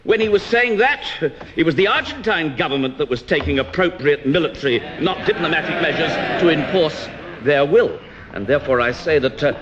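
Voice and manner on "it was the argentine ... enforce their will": military stressed, diplomatic rising to a shout; rising shout on not diplomatic measures; contrast stress. Real, not acted. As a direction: hurl his own words back at him. Turning the minister's words back.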